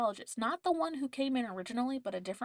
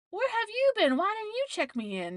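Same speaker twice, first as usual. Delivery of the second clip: mock indignant question; 11 semitones higher, 5 dB louder than usual. Retold demand at the nurse.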